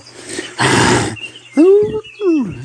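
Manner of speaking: imitated distant howl; high, hollow "hoo" howl. Narrator imitating the creature in the woods, howling. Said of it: "As they heard a howl".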